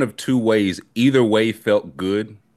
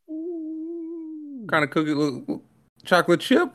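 mock high squeal; 5 dB quieter, slower than usual. Mock excited cookie question.

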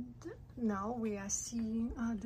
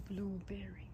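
whisper; 3 semitones lower, 6 dB quieter than usual. Real, not acted.

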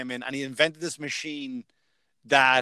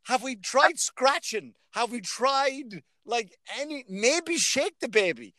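mock earnest suggestion; 7 semitones higher, slower than usual. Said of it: "I'm like".

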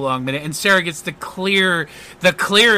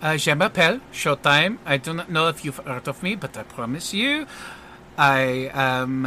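put-on French accent. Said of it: "he turns around and he goes".

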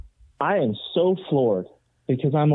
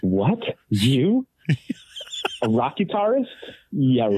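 mocking disbelief; slower than usual. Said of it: "the guy just looking at me like".

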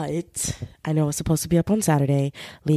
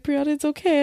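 soothing roommate; 9 semitones higher than usual. Retold or imitated. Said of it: "my roommate was like".

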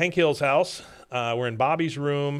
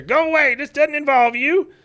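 high, loud mock dismissal; irritated retold yell; 14 semitones higher, 7 dB louder than usual. Father yells from behind the door, irritated. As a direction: holler them away as the cartoon father.

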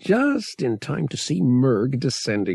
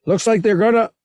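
loud, high warning shout; yelling; 11 semitones higher, 8 dB louder than usual. A narrator's reading. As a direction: yell an urgent warning, cut off at the end. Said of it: "Han yelled".

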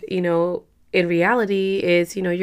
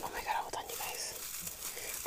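hushed whisper, all breath; sudden whisper; 22 dB quieter than usual. Real, not acted. Stopping her intro to the viewers, whispering. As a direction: drop to a whisper to stop the viewers.

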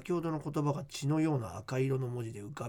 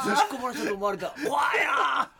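mock horrified shout; 11 semitones higher, 6 dB louder than usual. Real, not acted. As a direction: wail it like a disaster.

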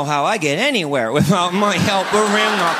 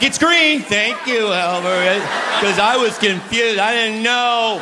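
mock gratitude; 5 semitones higher, slower than usual. Thanking his wife at the green light, mock grateful. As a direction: thank her with fake humility. Mocking himself thanking the helper.

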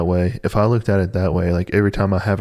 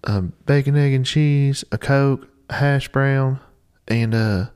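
lightly voiced coworker, higher pitch, drawn-out start; imitated coworker; 6 semitones higher, slower than usual. Coworker admitting what she had this morning, imitated. Acts out coworker listing her breakfast.

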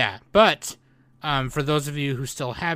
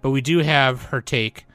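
usual speaking voice

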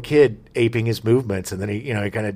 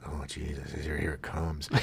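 hushed dread; 9 semitones lower, 14 dB quieter than usual. Retold or imitated. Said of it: "Hooper's like".

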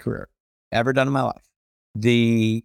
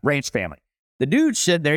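ordinary talk, nothing marked

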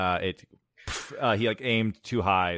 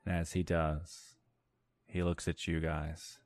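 ordinary delivery

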